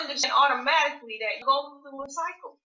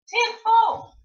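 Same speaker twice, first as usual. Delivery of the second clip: much higher and louder, punched out; emphatic; 10 semitones higher, 9 dB louder than usual. Real, not acted. Speaker to listeners, repeating Tenfold as a loud exclamation. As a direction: belt the word Tenfold as a proud promise.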